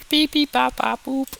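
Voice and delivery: high squeaky machine beeps; robot beeps. Retold or imitated. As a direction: chirp it like a little gadget. Imitating machine noises.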